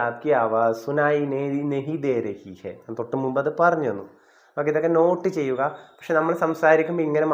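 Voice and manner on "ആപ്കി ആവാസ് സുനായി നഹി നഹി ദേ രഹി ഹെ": slow demo. Shown as a demonstration. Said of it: "അങ്ങനെയും പറയാം നമുക്ക്".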